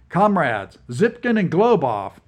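loud, official announcing tone; formal general. Acted. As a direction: address them like a general. General addresses prisoners formally.

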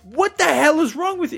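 high-pitched incredulous tirade; pissed-off rant. Retold or imitated. Driver re-enacts chewing out the man who rear-ended him, furious high rant. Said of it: "I'm pissed".

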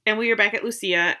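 ordinary delivery

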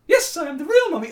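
hammed-up high mummy reply; silly put-on lady voice. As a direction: brightly affirm in a fake mum voice. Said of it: "affected a stupid female accent".

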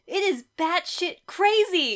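loud, high, drawn-out enthusiasm; gleefully emphatic defence; excited argument. Real, not acted. Playful argument defending a loved book.

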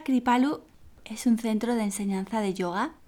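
normal talking voice

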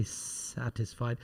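"satisfied" opening on a long S; drawn-out S. Shown as a demonstration. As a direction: linger on the first sound of "satisfied", softly. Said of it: "Let the simple slip of the tongue come out".

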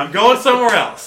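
high, loud huffy exit line; loud sharp voice. Pretend. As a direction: storm off in a huff. Continuing coffee rant.

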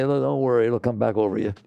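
ordinary delivery

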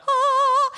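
high, loud swooning "oh"; rapturous "oh". Retold or imitated. Marvelling at a washer in New York, rapturous "oh". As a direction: sing out a blissful "oh".

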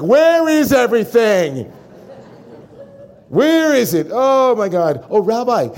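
hammed-up frantic outburst at home; frantic home yell. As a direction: rage around the house hunting for things. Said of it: "And then you go home, it's like".

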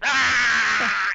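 high hammed-up cry of fake pain; dramatic tauntaun wail. Host voices the dramatic tauntaun, high hammed-up wail. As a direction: overact a creature's fake injury. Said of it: "dude, I barely touched you".

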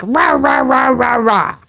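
repeated high rahs, falling off at the end; mock angry rahs. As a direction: chant out mock fighting noises. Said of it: "putting his fist up and he's like".

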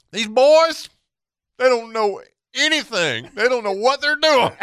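very high, loud mock female voice; drunk woman. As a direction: play a drunk woman hollering over the rail. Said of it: "she is so drunk she can't stand up".

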